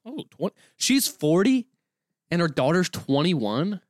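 surprised. Real, not acted. Shocked at mother's age.

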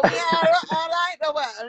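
high, loud put-on lad voice; mocking swaggering lads. Singer mocks lads posing as [name], high and loud. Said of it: "and they go".

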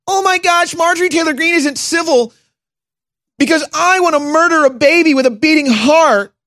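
high sarcastic mimicry; mock the opponent, whiny and high. Mocking the Democrat's voice.

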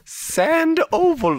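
Sand oval said slowly as two words, high; high, slow correction; split syllables. Shown as a demonstration. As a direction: break Sandoval into sand and oval. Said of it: "Send over".

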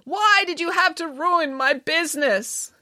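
high, slow tearful reproach; mock sobbing. A narrator's reading. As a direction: lament it with hammed-up despair. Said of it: "sobbed Missus Heseltine".

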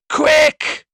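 piercingly high, very loud scream; shriek. A narrator's reading. Desperate sister begs for a doctor, shrieking. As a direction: shriek it at the top of your voice. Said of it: "ended in a shriek on the last word".

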